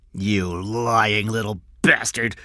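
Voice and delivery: slow, loud, menacing accusation; angry shout. A narrator's reading. Cy shoves the boy, shouting angrily. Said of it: "Cy shouted".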